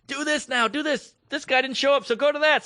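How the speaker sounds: high-pitched urgent stage crew voice; frantic crew instructions. Retold or imitated. Crew with whiteboards directing the host live, high and urgent. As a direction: fire off cues in a high, pressed voice. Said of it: "big whiteboards going like".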